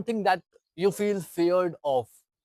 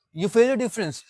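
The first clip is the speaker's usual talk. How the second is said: usual speaking voice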